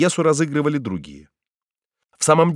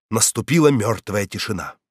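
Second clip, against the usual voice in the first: ordinary delivery, nothing marked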